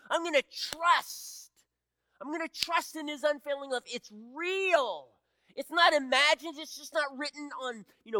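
loud, high preaching at full force. Acted. Pastor urging believers to trust God's love, loud and high. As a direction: drive it home to the congregation.

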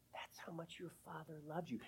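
soft tender whisper. Real, not acted. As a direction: breathe it gently like a father's comfort. Tender closing of father story.

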